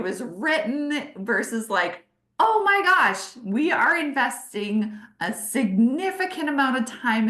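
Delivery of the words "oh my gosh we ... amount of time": gushing excitement. Shown as a demonstration. Voicing the ideal vision message.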